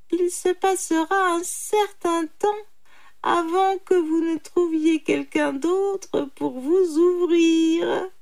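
high, loud animal voice; triumphant jackal. Acted. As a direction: address him slyly, pitched high. Jackal taunts tiger. (chacal triomphant, voix aiguë)

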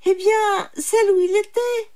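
high, loud insistence; insistent. A narrator's reading. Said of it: "insista le brahman". (insistance forte et aiguë)

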